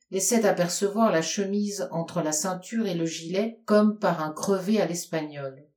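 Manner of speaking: ordinary delivery, nothing marked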